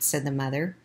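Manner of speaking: ordinary delivery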